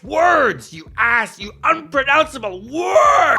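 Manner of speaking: high, loud cursing rant; angry yelling. Acted. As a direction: scream at the unpronounceable word. Ranting at the word.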